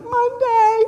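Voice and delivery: high, loud laughing answer; delighted laugh, high and loud; laughing eagerly. Retold or imitated. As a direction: blurt it out laughing. Eager reply to meal offer.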